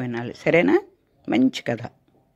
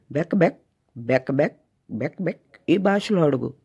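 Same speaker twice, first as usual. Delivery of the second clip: frog croak. Acted. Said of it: "చూడు నా భాషలో అడుగు".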